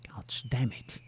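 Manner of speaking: breathy under-the-breath swearing; annoyed whisper; whispered curse. Real, not acted. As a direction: mutter the curse to yourself, voiceless. Frustrated muttered curse.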